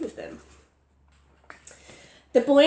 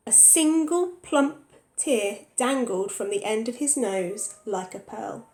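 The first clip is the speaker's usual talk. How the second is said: ordinary delivery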